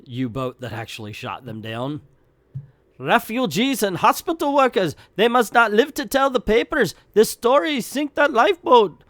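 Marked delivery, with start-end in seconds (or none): 3.0-9.0 high, loud barking officer order; German officer voice. Acted. Nazi commander orders his crew to sink the lifeboat, loud and high. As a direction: bark it like a villainous Nazi commander.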